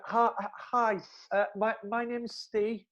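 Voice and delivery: high, slow, timid greeting; nervous lad introducing himself, lightly voiced; super polite. Shy new lad greeting the two girls, high and slow. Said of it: "really super polite".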